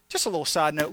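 normal talking voice

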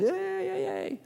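high, soft mock cheer of a little kid; a small kid's hushed yay; a hint of a child's glee over a football.

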